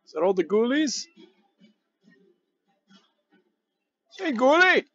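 high, teasing sing-song call; playful calling. Pretend. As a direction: coax the monsters out playfully.

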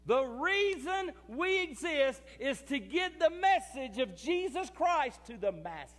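rising into a loud, slow preaching cry; building sermon. Acted. Pastor driving the point home to his church, building to a loud, slow preaching cry. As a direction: swell from talk to a ringing pulpit shout.

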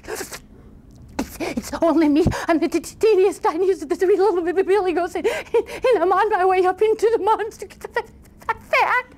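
high, stammering tiny voice; frightened little goat. Acted. Tiniest goat answering the troll, timid high stammer. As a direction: squeak it out, tripping over the consonants.

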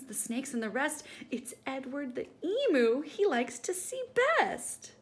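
high, loud thrilled emu; delighted Edward. Acted. Edward realising the gentleman loves emus, thrilled. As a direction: bubble over with pride.

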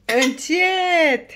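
high, loud, drawn-out warning. Real, not acted. Parent telling a small child to slow down, cautioning.

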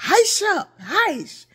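high, loud mock scolding shush; "Heish up" called out high and sharp; mother shushing. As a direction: hush the kids like mother did. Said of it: "My mother's phrases and saying".

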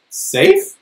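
high disbelieving Safe?; incredulous. Acted. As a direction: echo the word in disbelief. Beaver incredulous.

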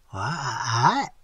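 drawn-out, quavering rising question; wobbly, stretched-out disbelief; feeble stammer. A narrator's reading. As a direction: stammer it weakly, caught out. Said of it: "stammered the feeble one".

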